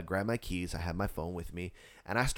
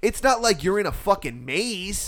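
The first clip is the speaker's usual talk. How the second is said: raised, forceful disbelief; exasperated rant; 6 semitones higher, 4 dB louder than usual. Retold or imitated. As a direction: pile up points, fed up. Acts out scolding the couple.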